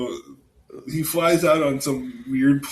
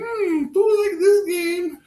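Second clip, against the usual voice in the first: put-on childish Buu voice; 4 dB louder, slower than usual. Voicing Buu.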